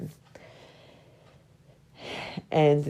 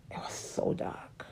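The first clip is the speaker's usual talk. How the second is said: hushed whisper; 10 dB quieter, slower than usual. Real, not acted. Reliving a dark moment, whispering.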